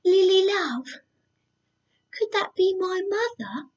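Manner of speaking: high small hopeful voice; hopeful piglet. Acted. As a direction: ask it like a timid piglet. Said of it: "asked William Wet".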